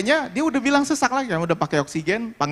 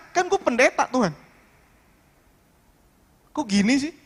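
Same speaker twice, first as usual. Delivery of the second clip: higher, exasperated plea of a let-down pastor; frustrated complaint to God; 4 semitones higher than usual. Retold or imitated. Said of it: "apa yang buat saya kesal". (keluhan kesal kepada Tuhan, nada lebih tinggi)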